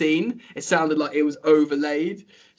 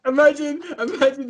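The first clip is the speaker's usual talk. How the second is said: excited; 10 semitones higher, 3 dB louder than usual. Real, not acted. Excited about the idea.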